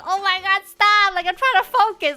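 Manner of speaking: loud, high mock-panicked plea; retold panic. Teacher cleaning a child's wound, acted-out panic. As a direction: beg them to stop, flustered and loud. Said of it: "I would panic".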